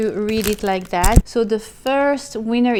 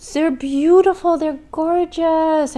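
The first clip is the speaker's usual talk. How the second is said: delighted praise; 7 semitones higher than usual. Real, not acted. Admiring gifts.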